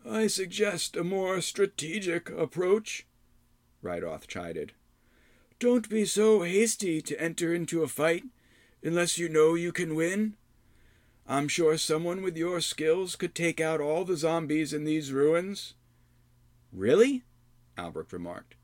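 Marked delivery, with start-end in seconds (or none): 0.1-3.1 high, slow lecturing hermit; chiding old man. Acted. Rydoth chided. 5.6-15.7 put-on chiding old man. Rydoth advises. 16.7-17.2 sudden high disbelieving Really; surprised. Acted. Albrecht surprised.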